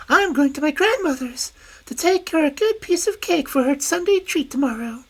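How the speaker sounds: high, quick and innocent; little girl eagerly telling the wolf her errand. Acted. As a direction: chatter like a trusting child. Girl character voice.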